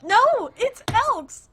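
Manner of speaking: high, slow, loud contradiction; real worked-up yell before laughing; exasperated shout. Real, not acted. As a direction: holler the correction, dragging it out. Shouted protest over pronunciation.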